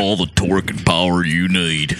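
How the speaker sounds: low announcer impression; truck-ad voiceover guy. Likening her voice to an ad, voiceover guy impression. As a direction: do the deep pickup commercial read. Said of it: "like the voiceover guy for the Dodge Ram".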